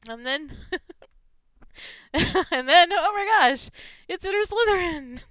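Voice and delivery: high, loud excited gush near tears; delighted outburst, voice cracking; overjoyed. Real, not acted. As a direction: squeal with joy. Overjoyed at another gift fic.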